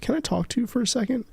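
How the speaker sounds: high, quick retold question; quick high-voiced imitation of a coworker asking; imitated coworker's request. Acts out coworker asking timidly.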